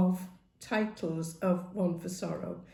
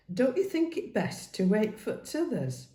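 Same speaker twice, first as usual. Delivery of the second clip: usual speaking voice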